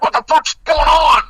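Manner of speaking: high-pitched mock outcry; imitating startled dogs. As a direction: yelp it like a freaked-out dog.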